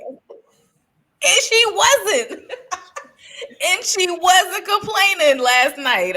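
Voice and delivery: slow laughing emphasis. Real, not acted. Laughing with her cohost about the bedroom joke. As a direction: laugh through the line.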